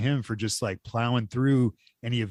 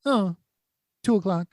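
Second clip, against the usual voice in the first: high, sing-song put-down of the crowd; mocking; 8 semitones higher than usual. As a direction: play the clueless crowd, much higher. Crowd's indifferent reply mocked.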